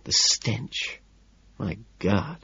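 very soft, breath-only disgust; hushed, gently appalled; soothing whisper. A narrator's reading. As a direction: whisper it slowly as if comforting. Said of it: "I whisper this soothingly".

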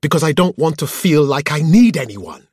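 high, loud outburst held at full pitch; shouted. A narrator's reading. Woman finally admitting to her partner why, shouting. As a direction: burst out shouting, voice way up. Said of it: "she shouted".

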